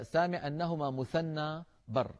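ordinary talk

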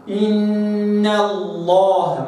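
slow إن الله with the noon kept thin; light noon. Shown as a demonstration. Teacher reciting the noon thin before Allah. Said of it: "ما قلش إن ال".

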